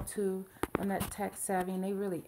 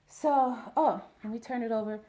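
ordinary talk, nothing marked

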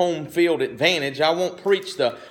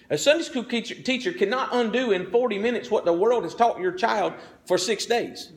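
raised, driving pulpit voice, a hint of full preaching; preaching time; 6 semitones higher than usual. Real, not acted. Pastor preaching to parents about Sunday school, rising intensity. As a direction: press the point home to the congregation. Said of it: "it's preaching time".

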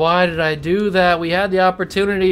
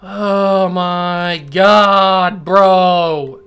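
very slow, stretched, louder moan; despairing groan; 7 dB louder, much slower than usual. Real, not acted. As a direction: drag it out in disbelief. Groaning after lost pick.

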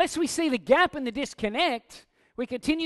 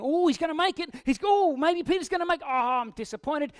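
higher, eager hope sagging into letdown; hopeful then disappointed Jesus; 6 semitones higher, 3 dB louder than usual. Retold or imitated. Jesus watching Peter on the water, mock hope turning disappointed. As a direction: play Jesus half-hammed, rooting for Peter then let down. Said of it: "As if Jesus himself was thinking".

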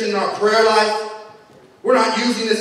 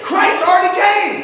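preaching at full force; 8 semitones higher, slower than usual. Real, not acted. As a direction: rise up and proclaim victory. Preacher building to climax.

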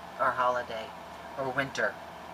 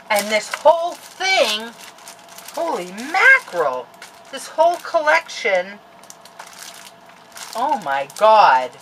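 high, loud, drawn-out awe; gushing excited; 6 semitones higher, 8 dB louder, slower than usual. Real, not acted. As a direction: gush in amazement. Gushing over collection.

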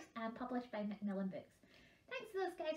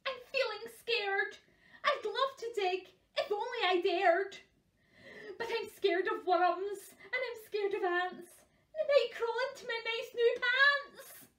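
scared cook; 8 semitones higher than usual. Acted. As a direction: play a timid cook working up into fright.

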